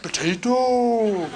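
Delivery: "Potato" extrem langsam, walisisch gedehnt; Welsh "Potato". Shown as a demonstration. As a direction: zieh "Potato" lang und verführerisch walisisch. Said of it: "she went fucking nuts".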